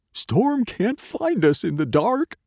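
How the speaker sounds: high, confident dog character. A narrator's reading. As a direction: reassure them as the dog. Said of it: "Dog told them".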